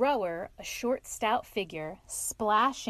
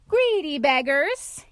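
loud, high, slow jovial scolding; hearty mock complaint; 5 semitones higher, 9 dB louder, slower than usual. A narrator's reading. Otter hailing the picnickers, hearty and loud. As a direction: call it out in teasing reproach.

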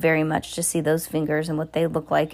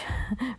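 witch cackle; 7 semitones higher, 8 dB quieter than usual. A narrator's reading.

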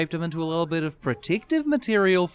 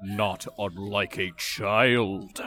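much lower, very slow, hollow boom; booming spooky voice; 7 semitones lower, 3 dB quieter, much slower than usual. Retold or imitated. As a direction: drag it out like a giant creature. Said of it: "It says in a booming, spooky voice".

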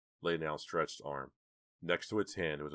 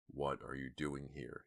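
low, soft, slow menacing growl; deep hollow growl; 2 semitones lower, 6 dB quieter, slower than usual. A narrator's reading. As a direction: growl it like a masked brute. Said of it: "he growled in a deep, hollow voice".

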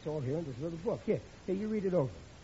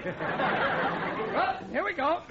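laughing here we go; 8 semitones higher, 3 dB louder, much slower than usual. Acted. Repeated start with laugh.

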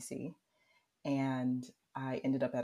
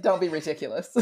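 loud, laughing brush-off; retold dismissal; 6 dB louder, faster than usual. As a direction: scoff it away with a laugh. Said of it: "usually I would be like".

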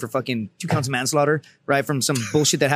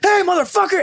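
high, loud imitated holler; mock shout at the culprits; retold angry yell; 5 dB louder than usual. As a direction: call out like an irate sentry in a story. Duty sentry yells up at Marines.

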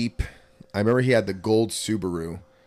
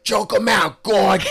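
high, loud yell from the stands; cageside shout; 12 semitones higher, 9 dB louder, much slower than usual. Retold or imitated. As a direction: bellow it like a coach at ringside. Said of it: "be like".

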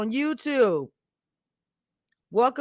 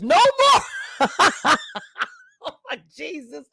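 high squeal dissolving into laughs; burst of laughter; 4 semitones higher, 3 dB louder, slower than usual. Real, not acted. Streamer cracking up at a viewer comment, laughing.

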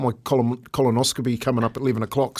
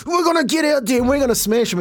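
high revved-up rallying cry; fired-up teammate; 11 semitones higher than usual. Retold or imitated. Scene: imitating Baz charging up teammates before a game, high and fired up. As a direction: pump the boys up like a hard man before kickoff.

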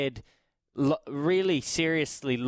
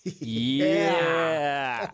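excited cheer; 3 semitones higher, 4 dB louder than usual. Real, not acted. Host joins live hype.

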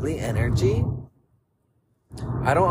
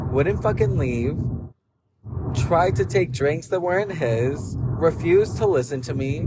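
normal talking voice

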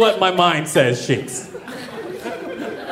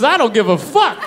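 ordinary talk, nothing marked

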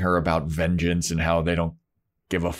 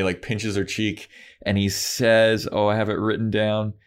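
ordinary talk, nothing marked

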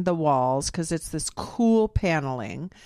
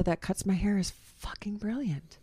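whispering; 8 dB quieter than usual. Real, not acted. Praising her hairdresser.

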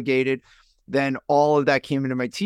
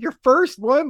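high-pitched, incredulous echo question; imitating a stunned listener; shocked; 12 semitones higher, 4 dB louder than usual. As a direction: mimic her baffled disbelief, voice shooting up.

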